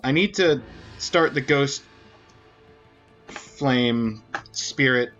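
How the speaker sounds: normal talking voice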